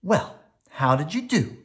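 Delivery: Mama picking the cubs up after tryouts; Mama asking. A narrator's reading. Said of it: "asked Mama".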